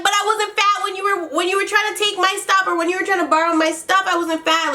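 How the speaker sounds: high-pitched, quickening mock protest; rapid-fire high retort; retold indignant comeback. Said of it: "And then she's like".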